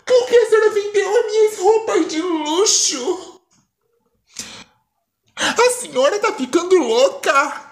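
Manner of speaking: put-on high, furious girlish voice. Daughter furious at her mother, in a high angry voice. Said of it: "fica furiosa".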